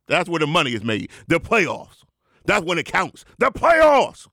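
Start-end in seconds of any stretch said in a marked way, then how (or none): 3.4-4.3 high, loud preacher cry; hyped proclamation. Acted. The Rev tells fans when it counts, loud hyped cry.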